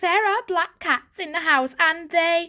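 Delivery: put-on anxious young cat insisting; urgent. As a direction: blurt the warning in a rush. Varjak urgently warning family.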